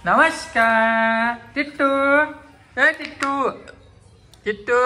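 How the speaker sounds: stretched, lilting greeting call. Real, not acted. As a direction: call to a little one, stretching the name. Calling out to Tittu in greeting.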